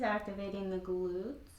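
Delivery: ordinary talk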